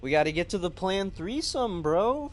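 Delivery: pumped, shouting to the chat; excited. Real, not acted. Hyped up after laughing fit.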